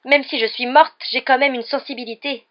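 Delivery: loud, high, wounded wail; tearful complaint. A narrator's reading. Mimi the ghost insists she has feelings, tearfully. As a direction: plead hurt feelings loudly. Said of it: "d'une voix sanglotante".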